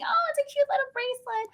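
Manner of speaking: high sweet shopper voice; customer voice. Retold or imitated. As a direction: gush like a customer. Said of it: "I could see somebody saying".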